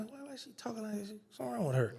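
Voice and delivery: puzzled hushed remark. Retold or imitated. Newly freed man puzzled by a video call, hushed and baffled. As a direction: mutter it so she can't hear. Confused by FaceTime at bus station.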